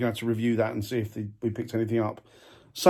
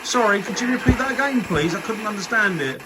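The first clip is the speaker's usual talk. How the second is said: raised, high voice calling out; 7 semitones higher than usual. Real, not acted. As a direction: call out to an unseen spirit. Calling out to spirits.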